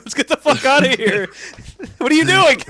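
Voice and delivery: high, loud yelp through laughter; mock-terrified shout, cracking up; Daivari panicking. Manager fleeing the lightning, laughing mock panic.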